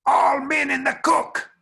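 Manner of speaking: high, drawn-out yell to the whole camp; retold boss hollering all men in the cook; trail boss shout. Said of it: "I hear the trail boss".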